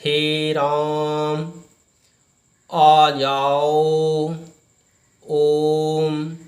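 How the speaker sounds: "हे राम, आ जाओ, ओम" with every vowel stretched very long, called slowly; stretched three-beat vowels in "हे राम", as if calling from afar; drawn-out calling vowels. Shown as a demonstration. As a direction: call "राम" and "ओम" out like a long chant, holding each vowel. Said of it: "जैसे".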